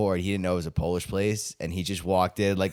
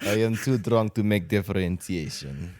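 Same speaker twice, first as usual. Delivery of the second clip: put-on Russian accent. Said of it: "Where this just Russian accent".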